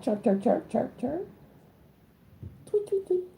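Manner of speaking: playful chirping, soft, ending high on the tweets; lightly voiced birdsong imitation, soft and climbing; mock bird chirps. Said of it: "Starts talking that bird talk".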